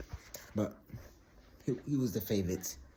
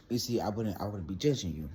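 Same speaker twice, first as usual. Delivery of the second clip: ordinary delivery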